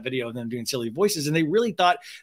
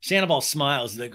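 ordinary delivery

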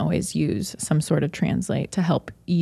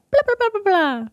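babbling fluently; 13 semitones higher than usual. Retold or imitated. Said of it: "I'd be like".

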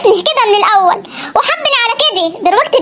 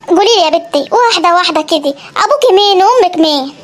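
put-on a wary old villager; old Saidi grandfather; 6 semitones lower than usual. Grandfather asking about the stranger, low and suspicious. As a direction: lower voice of a suspicious old man.

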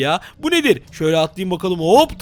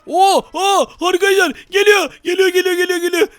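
panicked shouting; 12 semitones higher than usual. Real, not acted. Crocodile attacking in game.

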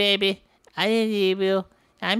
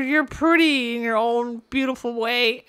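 ordinary talk

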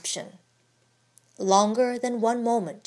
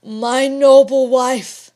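slow, louder and full of feeling; deeply moved; 3 semitones higher, 9 dB louder, slower than usual. A narrator's reading. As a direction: let emotion swell the words. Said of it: "deeply moved".

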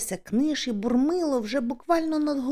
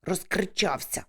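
quiet, low grumble; narrated irritated hiss; hissing through teeth; 3 semitones lower, 4 dB quieter than usual. A narrator's reading. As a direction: scold under the breath. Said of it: "сичить крізь зуби Ява".

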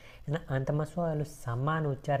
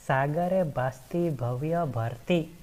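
slow recitation; slower than usual. Shown as a demonstration. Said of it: "કયું છે?".

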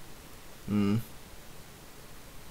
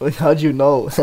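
louder and higher, through real laughter; laughing; 4 semitones higher, 5 dB louder than usual. Real, not acted. Streamer teased by friend about staying wide awake, laughing. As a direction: laugh while asking it.

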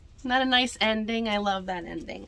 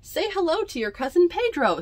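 mother's voice; 9 semitones higher, 4 dB louder than usual. Acted.

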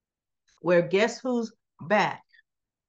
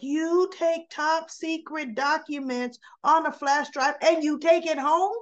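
raised pitch, spelling out the outrage; incredulous scolding; 8 semitones higher than usual. Retold or imitated. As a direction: question them as if you can't believe it. Others scold her in story.

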